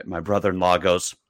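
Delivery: ordinary talk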